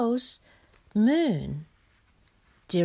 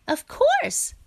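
high-pitched, mildly boastful Frog; a touch of pride, pitched right up; proud. A narrator's reading. Frog boasts to Hare about his pupil, proud. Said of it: "said Frog proudly".

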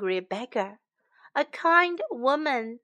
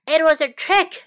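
loud angry yell; furious at being tricked; 4 semitones higher, 8 dB louder than usual. Acted. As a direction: Shen yelling when he finds his pears gone. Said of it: "he yelled".

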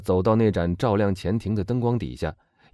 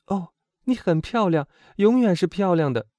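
high, gushing praise; adoring girl, high and emphatic; admiring exclamation; 12 semitones higher, 5 dB louder than usual. A narrator's reading. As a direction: cry out in admiration, pitched high. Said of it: "罗西喊道".

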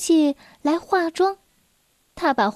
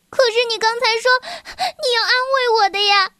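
higher and fast, close to crying; a scared child pleading; tearful protest; 8 semitones higher, faster than usual. Acted. Girl protesting to the lion who grabbed her, tearful and fast. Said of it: "米奴狮抗议道".

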